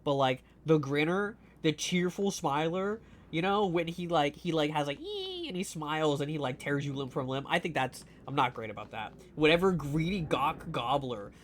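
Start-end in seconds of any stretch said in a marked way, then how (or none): 5.0-5.5 mock squeal. Imitating monster's grin sound.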